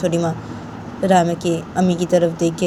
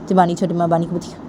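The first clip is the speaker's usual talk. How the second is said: ordinary delivery, nothing marked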